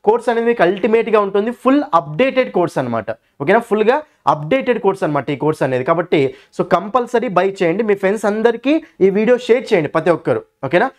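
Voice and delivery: teacher plugging his course app to students, lively ad read. Acted. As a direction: pitch the course like a promo.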